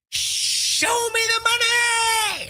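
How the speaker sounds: high, slow stretched-out scream; drawn-out movie-line yell. Pretend. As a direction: belt the catchphrase like Jerry Maguire. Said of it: "if you can't scream it and do it like Jerry Maguire".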